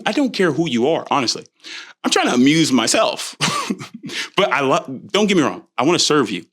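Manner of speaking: usual speaking voice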